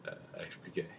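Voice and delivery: put-on French accent, whispered; breathy French-accented whisper. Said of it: "French accent the whole time".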